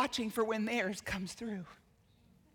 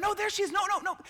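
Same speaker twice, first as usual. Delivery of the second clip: eager parent; 8 semitones higher, faster than usual. Retold or imitated. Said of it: "all the parents are going".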